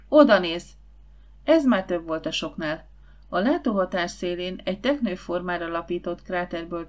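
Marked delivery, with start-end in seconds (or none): 0.1-0.7 loud, urgent call to look; exclaimed cry. A narrator's reading. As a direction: cry out to point something out. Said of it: "kiáltott fel Zsuzsi". (hangos, sürgető felkiáltás)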